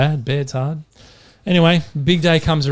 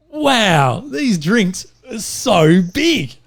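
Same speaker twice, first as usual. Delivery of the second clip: amazed; 6 semitones higher, much slower than usual. Acted. Blind man amazed by huge mug.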